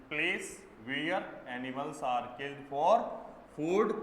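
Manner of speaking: slow, word by word, dictating to students; slow dictation. Shown as a demonstration. Teacher dictating a definition slowly word by word. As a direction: spell it out slowly for the class.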